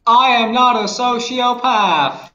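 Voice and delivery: slow, high robotic reader; text to speech voice. Pretend. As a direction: read it like a flat TTS bot. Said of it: "Can you actually do a text to speech voice?".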